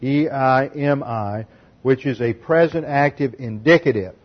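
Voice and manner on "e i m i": slow letter-by-letter spelling of eimi; spelled out slowly. Shown as a demonstration. Preacher spelling the Greek verb for the congregation, slow and deliberate. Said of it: "main verb is eimi".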